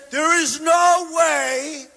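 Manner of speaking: slow, shouted, high mocking rant; a loud, slow, over-the-top imitation of an outraged official; mocking angry quote. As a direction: ham up the indignant refusal, slow and loud. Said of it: "So he is saying".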